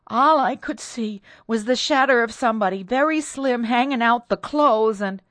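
high, loud, distressed account; horrified maid. A narrator's reading. Terrified maid describes a shadow, high and horrified.

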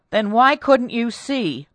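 loud, slow, high question; exasperated demand. A narrator's reading. Mistress presses her maid, loud and exasperated. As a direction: press her slowly and forcefully.

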